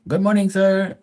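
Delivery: high, eager and very polite; polite pool guy voice. Retold or imitated. Pool guy greeting the homeowner, high and very polite. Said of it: "And he's like".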